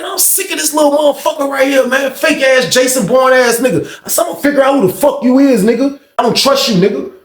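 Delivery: high-pitched, fed-up rant; worked-up, accusing tirade; retold angry outburst.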